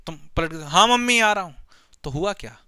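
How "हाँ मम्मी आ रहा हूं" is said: put-on child shouts back to mom; loud call back to mother.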